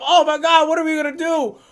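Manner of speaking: high, loud mock panic; mock freak-out. Friend mimics the panicking Discord crowd, in mock panic. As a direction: wail it loudly, imitating panicked people. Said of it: "everybody's freaking out".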